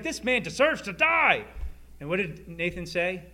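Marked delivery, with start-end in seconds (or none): 0.0-1.5 higher, louder outraged verdict. Retold or imitated. David demanding death for the rich man, outraged.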